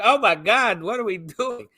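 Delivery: high, loud mock alarm; imitated panicked exclamation; retold panic. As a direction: cry out in acted panic. Retold panic at gun being shaken.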